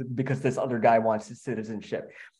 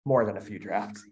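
low, soft self-correction; wry quiet aside; 5 semitones lower, 6 dB quieter than usual. Real, not acted. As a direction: drop it under your breath, low.

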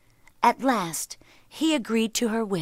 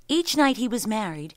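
plain reading voice

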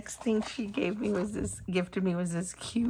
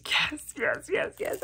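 excited; 7 semitones higher than usual. Real, not acted. Thrilled over pins.

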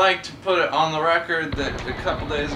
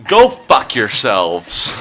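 drawn-out angry shout; yelling; 13 dB louder, much slower than usual. Real, not acted. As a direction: bark it out slowly. Angry outburst at teammates.

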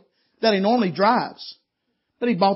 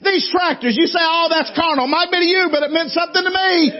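full-force preaching; 6 semitones higher, 4 dB louder than usual. Acted. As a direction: preach it out, building to the peak.

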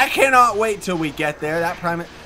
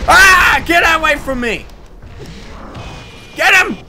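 panicked yell; 7 semitones higher, 7 dB louder than usual. Real, not acted.

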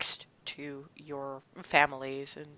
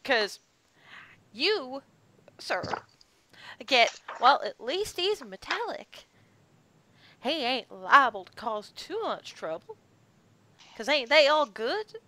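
country ranch woman, wry; 8 semitones higher than usual. Acted. GM voices Lisa.

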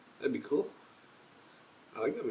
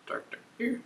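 whispered aside; 10 semitones higher, 10 dB quieter than usual. Real, not acted. Looking at beer colour.

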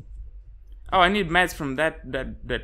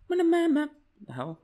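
startled; 13 semitones higher than usual. Real, not acted. Startled in horror game.

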